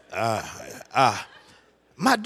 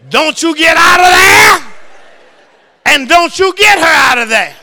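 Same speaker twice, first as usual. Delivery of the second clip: loud, high and slow laying down the law; mock stern wife's order, preached; stern command; 13 semitones higher, 20 dB louder than usual. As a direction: proclaim it like a decree from on high. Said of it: "The word was".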